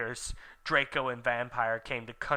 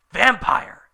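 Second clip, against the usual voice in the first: loud high startled cry; surprised; 7 semitones higher, 9 dB louder than usual. A narrator's reading. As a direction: blurt out the name in shock. Surprised exclamation.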